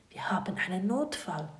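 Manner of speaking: tonlos geflüstert; Flüstern. A narrator's reading. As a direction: heimlich flüsternd warnen. Tintenfisch flüstert Notfall. (Tintenfisch flüstert dem Kind den Notfall zu)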